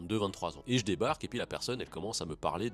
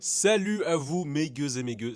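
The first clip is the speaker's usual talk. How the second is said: salut enjoué; 7 semitones higher, 6 dB louder than usual. Acted. Salutation d'ouverture, voix haute.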